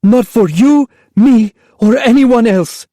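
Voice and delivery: high, loud, bitter cry; mother raging at lost dreams; bitter angry mother. Acted. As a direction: spit it out in despair. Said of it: "hoarse with exhaustion and anger".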